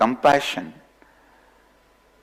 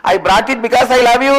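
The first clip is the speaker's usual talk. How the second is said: much higher, louder and fast, blurted in fright; shy and scared; 12 semitones higher, 8 dB louder, much faster than usual. Acted. Cub blurting love to the lion before running, scared and rushed. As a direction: blurt it out and bolt. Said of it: "This fellow feeling little fear and shy".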